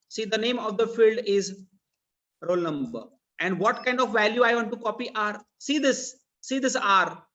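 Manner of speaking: ordinary talk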